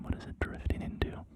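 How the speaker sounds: usual speaking voice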